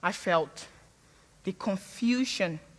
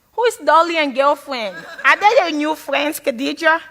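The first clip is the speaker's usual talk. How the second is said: a touch of a puzzled mother, louder and higher; mock mother; 5 semitones higher, 8 dB louder than usual. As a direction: lightly play the baffled African mum. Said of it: "she said to me".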